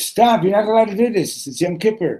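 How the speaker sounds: high, loud objection; alarmed protest. Acted. Eighteen-year-old forbidding Kiddush on Yom Kippur, loud protest. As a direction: cry out to stop a violation.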